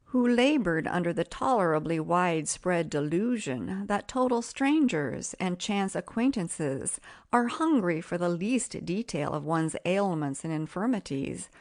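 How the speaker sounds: usual speaking voice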